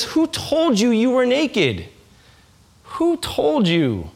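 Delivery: slow, tender question from God; gentle question. Acted. Preacher voicing God asking his people, slow and tender. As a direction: ask it slowly and gently, like a loving parent.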